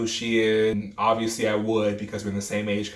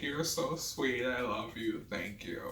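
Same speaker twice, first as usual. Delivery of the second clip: soft gratitude; 2 semitones higher, 10 dB quieter than usual. Retold or imitated. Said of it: "I'm like".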